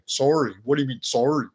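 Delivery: "sorry" echoed back with the Canadian "sore" vowel, puzzled; mimicking Canadian "sorry". Americans questioning the newcomer's word, mimicking "sorry" as "sore-ee". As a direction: query "sorry" as "SORE-ee", imitating the accent. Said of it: "And they go".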